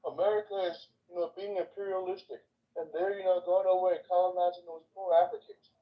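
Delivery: a hint of sneering critics; mocking critics. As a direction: parody the outraged critics.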